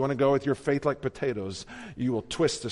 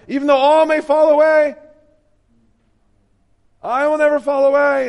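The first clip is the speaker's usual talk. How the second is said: loud, high, cocky vow; bold boast; 12 semitones higher, 13 dB louder than usual. Acted. Peter boasting to Jesus, loud and high. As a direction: boast it like you'd never fall.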